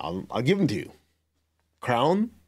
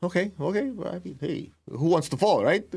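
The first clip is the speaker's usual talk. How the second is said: higher, sheepish backing down; higher, sheepish giving in to the teacher; lightly conceding; 5 semitones higher than usual. Retold or imitated. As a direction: shrug it off, a little embarrassed. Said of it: "I go".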